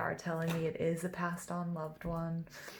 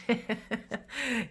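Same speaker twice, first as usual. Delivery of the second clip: short delighted laugh, higher; happy laugh; 5 semitones higher than usual. Real, not acted. Reader laughing with joy at the spirit team. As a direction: let a joyful laugh burst out. Joyful laugh mid reading.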